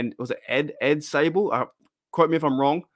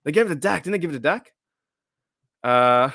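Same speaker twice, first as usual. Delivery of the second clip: normal talking voice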